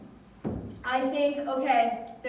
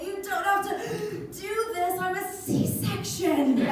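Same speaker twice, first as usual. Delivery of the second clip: high-pitched comic protest; hammed-up labor-room panic; retold frantic protest; 8 semitones higher, 4 dB louder than usual. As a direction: protest to the nurse, stretching the last words high.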